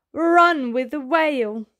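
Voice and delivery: usual speaking voice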